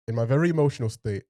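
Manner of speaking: normal talking voice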